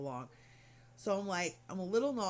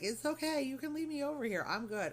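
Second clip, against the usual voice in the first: high-pitched, insistent reassurance; reassuring brush-off; 9 semitones higher than usual. Retold or imitated. Patient tells staff she can stay alone, reassuring brush-off. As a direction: wave them off in a high voice. Retells politely declining company.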